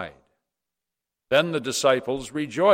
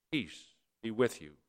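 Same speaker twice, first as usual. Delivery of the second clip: softly, almost under the breath; gentle quiet greeting; 10 dB quieter than usual. Acted. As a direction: hush the words as a blessing. Jesus greets Thomas and the disciples.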